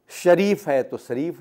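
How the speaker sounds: شریف first with ش, then with a س sound, like sarif; right then wrong. Shown as a demonstration. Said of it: "شریف ہے تو شریف ہو جائے گا". (شریف صحیح، پھر سریف غلط)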